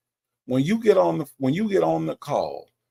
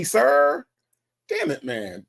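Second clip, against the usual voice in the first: normal talking voice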